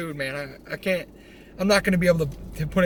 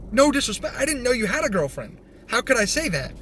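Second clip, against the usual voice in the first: higher-pitched, defensive protest; mock protest; 6 semitones higher than usual. Roommate denying he insulted the girlfriend, protesting. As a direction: plead innocence in a raised pitch. Protesting innocence in retold argument.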